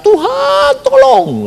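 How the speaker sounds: high-pitched mock cry to God; imitated cry for help. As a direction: call out high as if begging rescue. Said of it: "teriak-teriak". (menirukan jeritan minta tolong)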